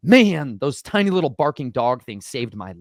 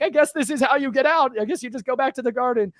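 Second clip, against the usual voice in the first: resigned musing; 10 semitones higher than usual. Retold or imitated. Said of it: "I'm like".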